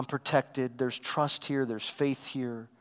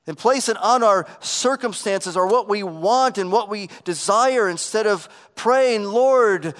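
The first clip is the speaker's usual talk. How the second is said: louder, higher impassioned sermon delivery; fervent preaching; 7 semitones higher, 8 dB louder than usual. Acted. Preacher urging the congregation to trust God, fervent. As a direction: preach it with rising conviction.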